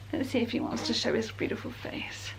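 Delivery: soft hushed whisper. Real, not acted. Whispering near the sleeping baby.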